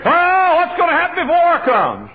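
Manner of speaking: high, loud mimicked fretting; mock worried wail. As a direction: wail it like a panicky churchgoer, hammed-up. Mimicking worried congregation's objection.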